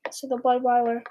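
ordinary delivery

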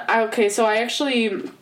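ordinary talk

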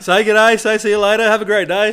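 loud, high exhortation; rally the listeners at full voice; rousing call. Real, not acted. Urging listeners to greet whoever they meet, rousing loud call.

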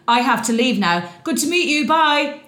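high, fast imitating his panicked exit; hurried high goodbye. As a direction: rattle it off like fleeing the table. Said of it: "And he".

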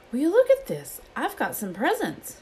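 high, excited burst; excited discovery. A narrator's reading. Harry discovers he has some presents, excited and high. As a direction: show off a wonderful surprise.

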